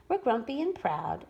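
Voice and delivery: usual speaking voice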